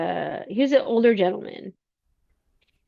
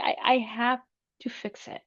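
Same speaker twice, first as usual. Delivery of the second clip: ordinary talk, nothing marked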